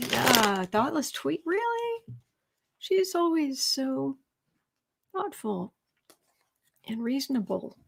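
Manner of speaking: mock-sincere sarcasm; sarcastic disbelief. Real, not acted. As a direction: sneer at it with fake sincerity. Mocking Meghan McCain's tweet.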